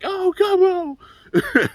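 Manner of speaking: very high, very slow drawn-out swoon; swooning fangirl. Retold or imitated. The fangirl sighs over her idol, a high drawn-out swoon. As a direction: melt over your idol. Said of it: "Athena's sitting there being a big time fan".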